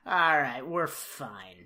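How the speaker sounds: low, soft, easy reassurance; understated calm with a hidden grin; Andy Griffith. Retold or imitated. Lawman facing the gun, quietly unbothered. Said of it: "goofy Andy Griffith smile".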